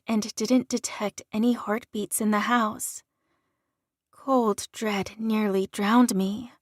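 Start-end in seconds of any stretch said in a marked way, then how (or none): none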